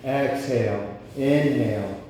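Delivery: loud, rhythmic breath commands, each word punched; breathwork cueing. Acted. Breathwork leader calling inhale and exhale to the group, loud and rhythmic. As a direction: drive the room's breathing like a coach counting reps.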